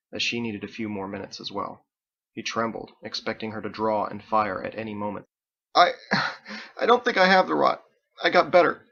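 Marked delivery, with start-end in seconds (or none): 5.7-8.8 high, loud, panicked denial; frightened pleading. A narrator's reading. Terrified boy pleading with his executioner, high and loud. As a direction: beg her not to shoot, voice climbing.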